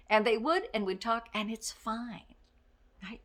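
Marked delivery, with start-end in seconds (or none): none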